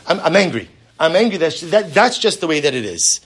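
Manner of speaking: cling to the anger like a sulking adult; stubborn anger. Acted. Grudge-holding adult voiced.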